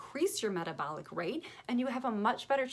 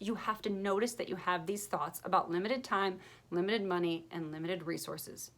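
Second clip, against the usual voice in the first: ordinary talk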